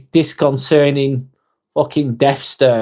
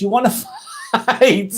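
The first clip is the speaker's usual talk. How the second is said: laughing read; 8 semitones higher than usual. Retold or imitated. Reading a viewer's joke challenge.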